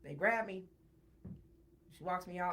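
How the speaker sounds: ordinary talk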